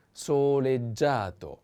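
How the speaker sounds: "soleggiato" drawn out syllable by syllable; slow model pronunciation. Shown as a demonstration. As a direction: stretch each syllable of "soleggiato" slowly.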